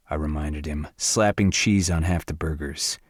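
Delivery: plain reading voice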